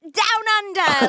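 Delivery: slow, high, hammed-up Aussie drawl; Aussie accent impression. Mocking Jason for the hosts, slow hammed-up Aussie. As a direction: stretch it out like a bad Australian. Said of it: "I can't do it".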